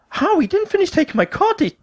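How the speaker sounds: very high-pitched, incredulous squeal; squeaky acted astonishment; shocked disbelief. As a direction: question it in high alarm. Learns booking was already paid.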